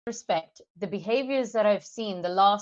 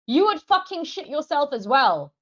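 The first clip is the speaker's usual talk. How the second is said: ordinary talk, nothing marked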